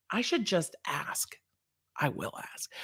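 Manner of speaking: hushed, slow, half-whispered; musing aside. Real, not acted. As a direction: drop to a near whisper, as if to yourself.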